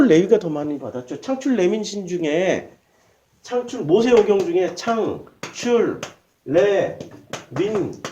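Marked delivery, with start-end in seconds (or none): 4.7-8.0 창출 레민신 very slowly, each syllable separate. Shown as a demonstration. Preacher spelling out five book initials for listeners, syllable by syllable. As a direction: break 창출 레민신 into single, spaced-out syllables.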